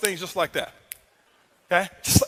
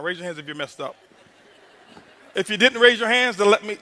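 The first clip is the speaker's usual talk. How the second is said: ordinary delivery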